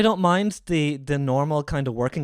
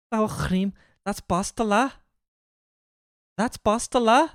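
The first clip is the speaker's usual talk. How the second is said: high Scouse with rising end; Scouse accent; 8 semitones higher, 4 dB louder than usual. Shown as a demonstration. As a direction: put on a higher-pitched Scouse. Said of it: "in a Scouse accent?".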